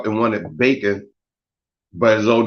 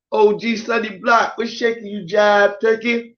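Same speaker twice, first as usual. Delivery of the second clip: much higher, slowing right down on jive turkey; high shout-out; 10 semitones higher than usual. Real, not acted. Shouting out a viewer in a high, drawn call. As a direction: call him out up high, drawing out the end.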